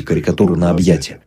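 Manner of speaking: ordinary delivery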